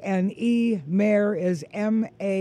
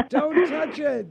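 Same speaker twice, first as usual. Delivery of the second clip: high, laughing playful warning; 8 semitones higher than usual. Real, not acted. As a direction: tease them through a laugh. Laughing, joking about money.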